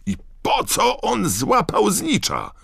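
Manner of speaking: very high, slow-stretched wail of protest; indignant roar. A narrator's reading. Ron protests the snitch catch, roaring. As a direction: bellow the question high and drawn out. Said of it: "ryknął Ron".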